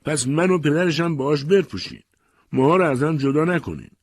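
high, loud desperate plea; acted mother's shouted appeal; crying out. A narrator's reading. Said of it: "فریاد زد".

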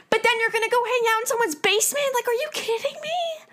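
are you kidding me, real frustration; heated disbelief. Real, not acted. Scolding followers who party in basements, exasperated. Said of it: "I'm getting so heated".

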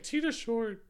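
high, put-on teasing voice; squeaky-pitched mock quote; mocking impression of co-host. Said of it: "so you're like".

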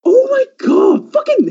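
high, slow, drawn-out wail; mock despairing wail. Real, not acted. Venting about the cousin, slow high mock wail. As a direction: wail it like you've given up.

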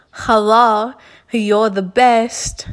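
slow, drawn-out creepy caller voice; imitating creepy caller. Mocking how Mister Chow talked on the phone, slow and creepy. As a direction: stretch it out like a sleazy stranger. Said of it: "he would talk like that".

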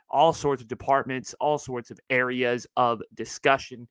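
usual speaking voice

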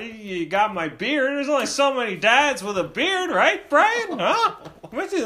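high-pitched, loud mock dad, questions tumbling out; exasperated dad. Dad hunting for his portrait on the wall, hammed-up exasperation. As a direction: narrate your search in a hammed-up exasperated voice. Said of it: "he's just so exasperated".

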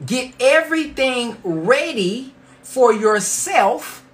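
slow, weighty scripture line; slow reading. Shown as a demonstration. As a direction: stretch every word out. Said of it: "I'm gonna read that one more time slowly".

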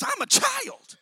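high, loud cry of protest; Jeremiah protesting. Acted. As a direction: cry out like a boy who feels too small.

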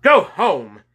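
high, loud shout; yelled order. A narrator's reading. Policeman to two boys, yelling. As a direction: yell it to send them away. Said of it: "yelled the policeman".

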